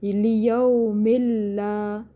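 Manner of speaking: slow chanted لیوم اللہ with a clean doubled laam; no ghunna. Shown as a demonstration. Teacher demonstrates merging, لیوم اللہ without any nasal hum. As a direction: recite لیوم اللہ drawn out, keeping the merged l out of the nose. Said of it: "یعنی غنہ نہیں کرنا".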